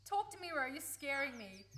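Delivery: urgently begging a scared friend to talk; a loud, frightened plea; alarmed. Acted. Friend suddenly alarmed, pleading.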